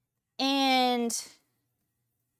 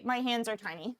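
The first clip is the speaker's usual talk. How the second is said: usual speaking voice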